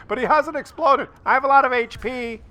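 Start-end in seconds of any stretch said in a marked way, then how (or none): 1.3-2.4 voicing the tank.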